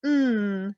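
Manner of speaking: "mm" voiced as a held nasal N; letter sound. Shown as a demonstration. Teacher showing the sound of Spanish N, held hum. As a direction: hold the "mm" as one long "nnn". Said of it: "It sounds like".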